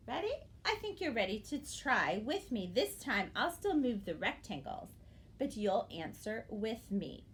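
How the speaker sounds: normal talking voice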